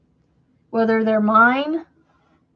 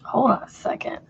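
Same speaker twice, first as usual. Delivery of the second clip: whispered aside; whispering. Real, not acted. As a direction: whisper it under breath. Whispering to herself.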